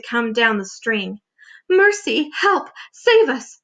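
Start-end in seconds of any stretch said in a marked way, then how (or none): 1.7-3.6 high panicked cries for help; frightened cries. Acted. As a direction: shriek for help as a terrified ladybug. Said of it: "cried the ladybug".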